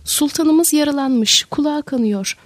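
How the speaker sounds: ordinary delivery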